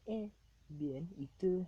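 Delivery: high, loud practice Spanish; retold Spanish small talk with an accent. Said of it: "quick little conversation to test out accents".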